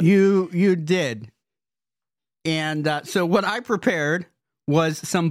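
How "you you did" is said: slow grudging. Real, not acted. Grudging reply.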